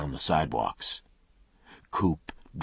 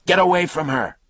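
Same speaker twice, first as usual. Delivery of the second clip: loud, higher shout from across the street; man yelling; 11 dB louder than usual. Acted. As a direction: bellow the warning down. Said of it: "a man's voice".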